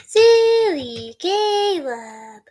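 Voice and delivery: very slow, drawn-out sing-song tease; silly teasing. Pretend. Streamer teasing Caleb, slow silly voice. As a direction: stretch it loud and high, sliding down.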